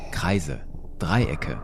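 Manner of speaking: ordinary delivery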